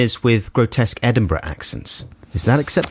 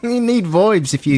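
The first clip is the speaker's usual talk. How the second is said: higher-pitched Aussie twang; mock Australian accent; 6 semitones higher than usual. Presenter joining the voibs joke, higher mock Australian. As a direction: put on an Australian mate's voice. Improvised Australian mates routine.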